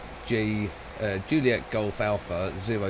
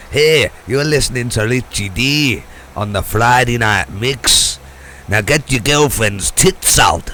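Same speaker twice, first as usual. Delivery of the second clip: loud hammed-up Scottish DJ jingle; mock Scottish accent; 11 dB louder than usual. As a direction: bellow it in a bad Scottish jock accent. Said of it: "in a Scottish jock accent".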